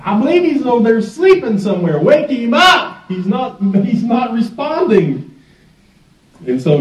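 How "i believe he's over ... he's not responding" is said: high-pitched taunting call; gleeful jeer, pitched way up; making fun. Acted. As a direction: heckle them in a high, mock-helpful holler. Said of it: "he starts making fun of them".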